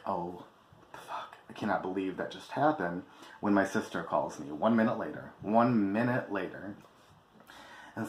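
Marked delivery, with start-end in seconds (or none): 0.1-3.0 whispered in shock; stunned whisper. Retold or imitated. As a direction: breathe it out, stunned. Stunned right after the fight.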